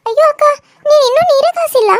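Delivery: high, loud shocked outcry; shocked exclamation. Acted. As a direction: gasp at sister-in-law in disbelief. Sonali shocked water not heated. (ಜೋರಾಗಿ ಆಶ್ಚರ್ಯದ ಉದ್ಗಾರ)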